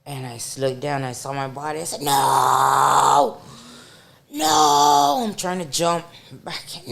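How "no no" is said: retold panicked scream. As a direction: yell it as you watch your body. Retold screaming at own body.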